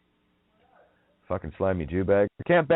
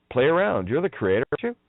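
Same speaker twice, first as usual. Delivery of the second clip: normal talking voice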